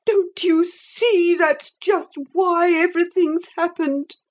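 high, tearful protest; lightly voiced weeping anger; a hint of furious tears; angry tears. A narrator's reading. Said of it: "in a burst of angry tears".

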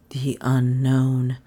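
ordinary delivery

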